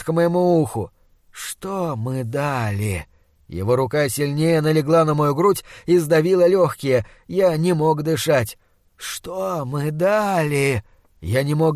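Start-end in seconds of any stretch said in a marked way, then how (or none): 1.3-3.1 slow, hushed, rotting monster voice. Acted. Monster demands answer. 9.0-10.8 slow, pressing creature voice; slow monster voice. Acted. Monster repeats demand.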